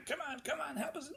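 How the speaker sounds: high coaxing bug voices; pleading mosquitoes. Acted. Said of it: "they're saying".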